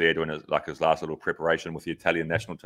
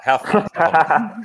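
high, loud pestering plea; pleading; 8 semitones higher, 5 dB louder, much slower than usual. Retold or imitated. Retelling how he badgered Filippo: loud, high, pleading. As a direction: beg him to spill his times, pushy and eager.

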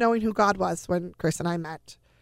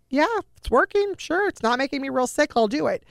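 high-pitched, upbeat voicing of a child agreeing; much higher, eager quoted reply; retold eager child; 7 semitones higher than usual. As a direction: imitate a game kid saying yes, pitched well up. Child retold eagerly accepting more chemo.

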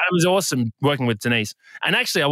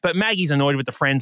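usual speaking voice

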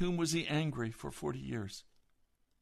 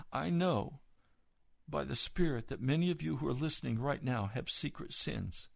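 usual speaking voice

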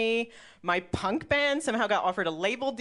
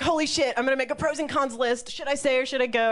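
raised pitch, frantic self-talk; retold freak-out; 5 semitones higher, 3 dB louder than usual. Comedian re-enacting freaking out over moving, higher and flustered. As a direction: spill it out higher, mock panic. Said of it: "I freaked out".

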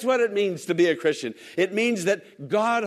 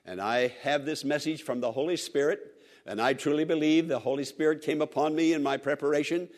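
ordinary delivery

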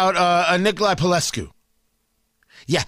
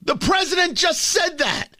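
much higher, shouted disbelief; outraged shout; 9 semitones higher than usual. Real, not acted. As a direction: let the outrage burst out. Host outraged at Biden remark.

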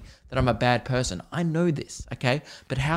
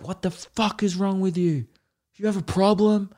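mock outburst; 6 semitones higher than usual. Man savouring the moment of yelling at a kid, hammed-up rage. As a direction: relish the fake outburst. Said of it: "as you're saying it".

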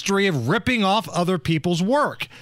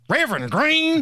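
mock Southern accent; 6 semitones higher than usual. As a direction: mimic Hillary pandering with a Southern lilt. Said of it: "you ever hear that accent come out".

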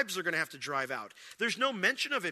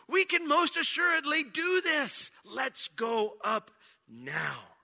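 assured rallying call; 6 semitones higher, slower than usual. Acted. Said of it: "because the idea is".

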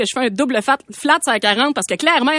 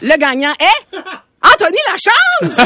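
hyped award announcement; 5 semitones higher, 4 dB louder than usual. Pretend. Mock awards host reveals winner.

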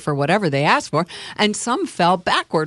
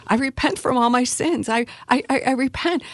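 higher, halting repentance; tearful stutter; 8 semitones higher than usual. Acted. Sobbing convert repeats the prayer, tearful and stammering.

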